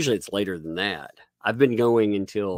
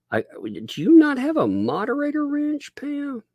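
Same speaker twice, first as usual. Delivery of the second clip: surprised; 3 dB louder than usual. Real, not acted. Surprised streamer finds mod missing.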